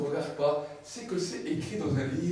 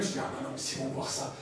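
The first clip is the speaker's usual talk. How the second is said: whispered panic, lower; worried whisper; 4 semitones lower, 6 dB quieter, faster than usual. Acted. Believer fearing everyone sees his sin, whispered. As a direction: hide and fret under your breath.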